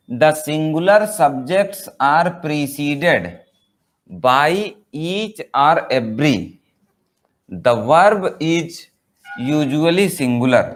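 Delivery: very slow, word by word; slow reading of a rule. Shown as a demonstration. Teacher reads the grammar rule to students, very slow. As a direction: read the rule aloud slowly for notes.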